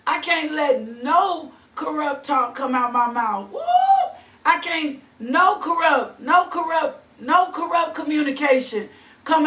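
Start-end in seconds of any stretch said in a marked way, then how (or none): none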